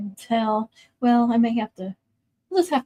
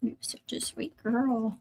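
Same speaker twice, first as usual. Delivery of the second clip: whisper; 9 dB quieter than usual. Real, not acted. Cooing to her dog.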